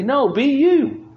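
normal talking voice

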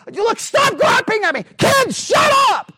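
put-on high, loud yell at the kids; a parent losing it on the drive home; mock angry parent. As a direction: snap at the family in the car. Family bickering in the car.

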